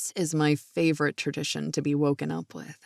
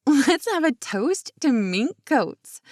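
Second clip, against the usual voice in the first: higher and louder, slow and giggly; silly giggly toast; 6 semitones higher, 5 dB louder than usual. Acted. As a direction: call out a silly toast, half laughing. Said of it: "cried out with a silly giggle".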